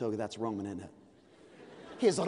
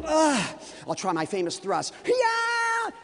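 high, loud attack yell; old David's war cry, pitched high and loud; battle cry; 11 semitones higher, 5 dB louder, slower than usual. Acted. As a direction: lunge in with a shout.

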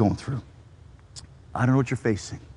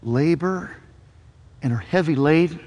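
softer, slowing to a breathed last word; gentle invitation; 3 semitones lower, 4 dB quieter, slower than usual. Retold or imitated. Pastor gives Jesus's invitation to the weary, soft and slow. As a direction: invite them into rest. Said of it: "Jesus says".

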